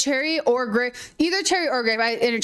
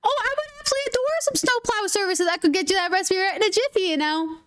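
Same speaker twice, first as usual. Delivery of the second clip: high, fast, chirpy Minnesotan accent; sing-song Minnesota nice, pitched up high; 8 semitones higher, faster than usual. Shown as a demonstration. Vlogger shows viewers a fun Minnesotan accent, high and fast. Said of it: "Minnesotan accents are just kind of really fun things to do".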